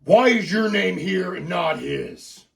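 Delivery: loud, slow demand; angry dad. Acted. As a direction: grill a son accusingly, drawing out the words. Dad accuses son over letter.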